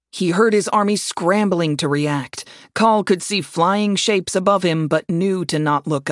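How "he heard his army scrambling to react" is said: usual speaking voice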